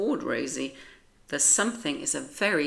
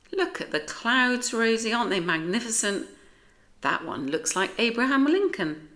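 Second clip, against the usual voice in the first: higher, fast and eager about the clouds; excited; 6 semitones higher, 4 dB louder than usual. Acted. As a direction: gush over the sky, quickly. George marvels at clouds.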